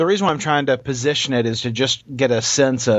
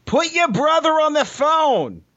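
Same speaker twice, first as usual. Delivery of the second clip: much higher, slow mock scolding voice; payphone woman impression. Retelling a woman yelling into a payphone, high slow mock voice. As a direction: imitate the fed-up woman barking orders, pitched way up and drawn out. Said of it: "And she's like".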